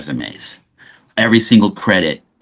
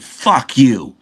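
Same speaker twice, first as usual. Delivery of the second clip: retold disbelief; 5 semitones higher than usual.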